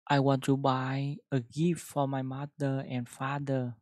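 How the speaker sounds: careful model sentence; loud, slow classroom demo; loud slow reading. Shown as a demonstration. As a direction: read I want to buy a gift loud and slow. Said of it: "Chúng ta sẽ đọc câu này".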